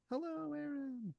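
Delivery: very high, soft, slow little hello; high, quiet, drawn-out greeting from grass; tiny grass voice. Retold or imitated. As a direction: breathe it out like tiny talking grass. Blades of grass with eyes greet.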